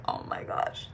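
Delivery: hushed whispered dismay. Retold or imitated. Sister whispering her shock once brother goes inside. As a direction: whisper it so nobody inside hears.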